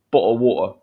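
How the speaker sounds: "bottle of water" London style, T's dropped; cut T's. Shown as a demonstration. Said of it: "They don't cut the T's".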